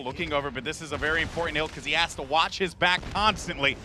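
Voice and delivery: excited esports caster, peaks high then settles; live play-by-play. Real, not acted. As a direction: call the kill, then track the action.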